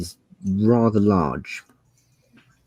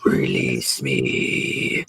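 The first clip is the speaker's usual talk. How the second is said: low, slow growled demand; a caged beast commanding to be freed; deep slow dragon plea; 8 semitones lower, much slower than usual. Acted. Dragon pleads from crate.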